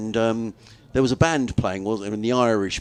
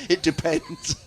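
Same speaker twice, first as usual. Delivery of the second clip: higher, through a laugh; laughing joke; 6 semitones higher, slower than usual. Retold or imitated. Joking about answering a stranger, laughing. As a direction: let the chuckle carry the reply.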